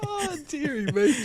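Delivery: high squeaky laugh-strained sigh. Real, not acted. Commentator cracking up at his co-host's remark, laughing. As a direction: wheeze it out mid laughing fit. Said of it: "Monkey, what is going on?".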